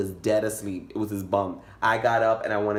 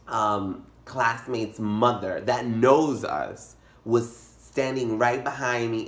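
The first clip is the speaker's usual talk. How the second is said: usual speaking voice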